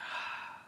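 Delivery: soft open-jaw sigh; relaxed sigh. Shown as a demonstration. Teacher demonstrates a relaxed out-breath sigh. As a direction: let the jaw drop and sigh it out. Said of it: "on the out breath it's like".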